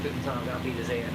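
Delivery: ordinary delivery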